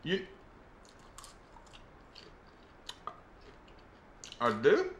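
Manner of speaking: put-on Southern accent. Actor auditioning as a Southern clerk serving a ma'am, Southern accent. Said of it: "putting on a Southern accent".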